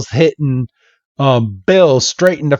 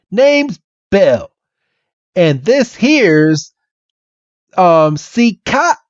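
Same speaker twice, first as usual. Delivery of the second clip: higher, slow Southern introduction; hammed-up Southern country fella; Bill; 6 semitones higher, slower than usual. Bill introducing himself to Red, slow Southern accent. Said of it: "this Southern accent".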